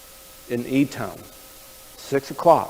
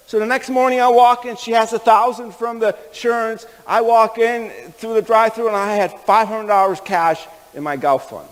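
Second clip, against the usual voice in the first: normal talking voice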